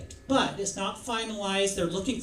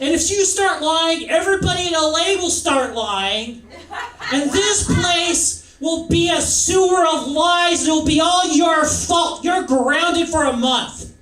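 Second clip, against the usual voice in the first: hammed-up shouting New York mother; New York mother accent; 8 semitones higher, 10 dB louder than usual. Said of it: "I gave her a New York accent".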